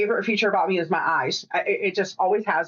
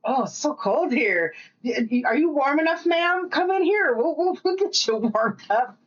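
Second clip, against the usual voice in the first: lightly acted fussing, climbing higher as it goes; fussing mover, imitated; 6 semitones higher than usual. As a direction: mimic a solicitous mover, pitch rising. Imitating Alaska movers fussing over her.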